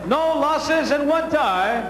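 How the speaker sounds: normal talking voice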